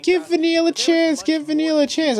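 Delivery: high, pleading plea to the fans; pleading actress. Retold or imitated. Actress pleading with fans, mimicked high and pleading. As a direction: beg them sweetly to give her a chance. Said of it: "she even pleads with fans".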